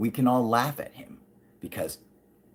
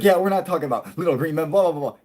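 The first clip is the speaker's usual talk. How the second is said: dismissive scientist; 7 semitones higher, 6 dB louder, much faster than usual. Retold or imitated. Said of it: "He's like".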